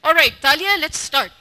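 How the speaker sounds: normal talking voice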